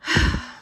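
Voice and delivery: a long, breathy unvoiced sigh; heavy sigh. A narrator's reading. Sad schoolchildren sigh together after their trip. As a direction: let the air out in defeat.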